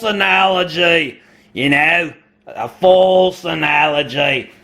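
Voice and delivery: mock Aussie, drawn-out vowels; Australian accent. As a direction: lean into a silly Australian accent. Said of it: "my use of an Australian accent".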